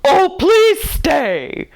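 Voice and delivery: put-on high, slow pleading old man; begging. A narrator's reading. Uncle Albert begs Mary Poppins to stay, slow and high. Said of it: "begged Uncle Albert".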